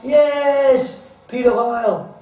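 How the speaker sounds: high, loud jeering yell; crowd shout. Retold or imitated. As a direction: shout it like a mocking mob. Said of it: "you all shouted".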